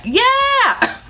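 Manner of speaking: very high, drawn-out mock whoop; imitated cheer. Family welcoming Disney jail, hammed-up excited cheer. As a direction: cheer like a whole gleeful family.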